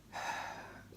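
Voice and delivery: a long soft unvoiced sigh. Shown as a demonstration. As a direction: let out a weary breath. Sigh in poem reading.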